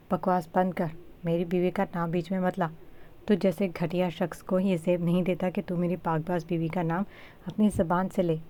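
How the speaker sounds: ordinary delivery, nothing marked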